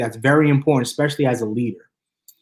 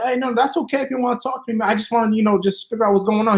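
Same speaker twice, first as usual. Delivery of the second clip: gentle; 6 semitones higher, faster than usual. Retold or imitated. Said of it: "I said".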